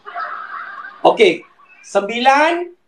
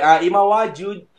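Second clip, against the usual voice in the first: normal talking voice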